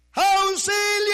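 high, loud, slow call into the jungle; cry for help. Retold or imitated. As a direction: yell for help as far as it carries. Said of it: "Comencé a gritar". (grito de auxilio, alto y prolongado)

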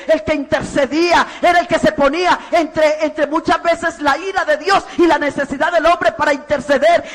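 loud, driving preacher's voice with pitch lifted; full-force preaching. Acted. Preacher calling fathers to be priests of the home, loud and driving. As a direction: hammer each line home to the congregation.

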